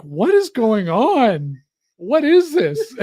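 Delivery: high, slow mock bewilderment; baffled disbelief. Wife reacting to the odd intermission, high mock disbelief. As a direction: exclaim it as a baffled viewer.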